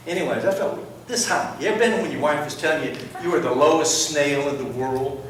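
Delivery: ordinary delivery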